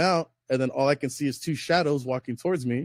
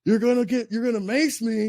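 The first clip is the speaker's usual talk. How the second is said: mocking ranger; 7 semitones higher than usual. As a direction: squeak out his disbelief to make him sound silly.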